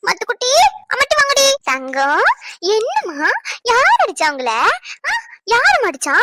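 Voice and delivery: put-on high cooing baby talk; mother comforting her crying baby in baby talk. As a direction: coo over the baby to comfort it.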